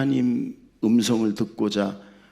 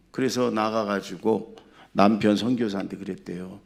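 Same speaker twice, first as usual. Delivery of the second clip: normal talking voice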